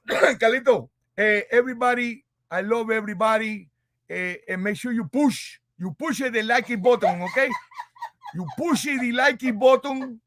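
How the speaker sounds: put-on light Cuban accent, coaxing; cheerful Cuban host voice. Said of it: "What happened to the Cuban accent?".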